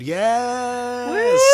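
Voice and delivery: high, loud cheer; thrilled yell of yes; excited shout. Real, not acted. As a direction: burst out with delight. Excited reveal of time loop.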